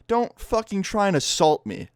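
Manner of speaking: high, slow, incredulous telling-off; exasperated scolding. Retold or imitated. Scolding the fan in retelling.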